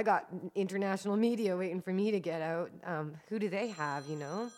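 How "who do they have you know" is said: ordinary talk, nothing marked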